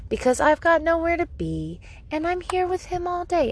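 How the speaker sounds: strained-sweet, high and lilting; patient singsong. Retold or imitated. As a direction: sing it out like a patient parent. Soothing herself with patient mommy talk.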